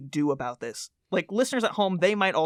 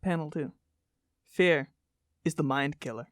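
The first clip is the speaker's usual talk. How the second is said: dramatic poster recitation. Acted. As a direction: intone each phrase like a cult creed. Reads poster litany aloud.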